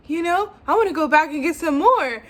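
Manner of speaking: eager excitement. Retold or imitated. Retelling own eager idea.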